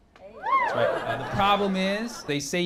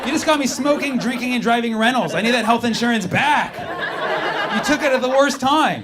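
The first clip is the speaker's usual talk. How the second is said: louder, higher indignant rant; hammed-up betrayed rant; mock outrage; 4 semitones higher, 7 dB louder than usual. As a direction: complain loudly like you were robbed. Ranting at losing insurance.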